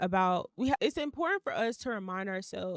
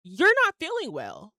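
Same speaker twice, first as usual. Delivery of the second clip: higher, louder, stern telling-off of herself; tough-love pep talk to herself, higher and louder; scolding self-talk; 3 semitones higher, 6 dB louder than usual. Retold or imitated. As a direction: snap yourself out of it like a bossy best friend. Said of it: "I'm talking to myself in my head like".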